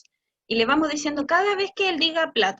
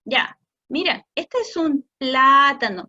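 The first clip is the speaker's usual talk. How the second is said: este es un plátano slow and louder, plátano stretched; slow corrective model; 5 dB louder, slower than usual. Shown as a demonstration. Parent gently modeling plátano for child, slow and louder. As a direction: calmly give the right word, slowly. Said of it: "le haces como más larga la parte difícil".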